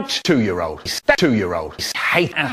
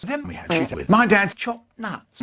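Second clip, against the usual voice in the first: ordinary talk, nothing marked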